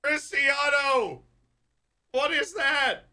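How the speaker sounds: very high incredulous yell; high-pitched stunned cry; excited shout. Real, not acted. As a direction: shout it in disbelief. Reacting to live match.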